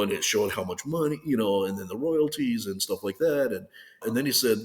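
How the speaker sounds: usual speaking voice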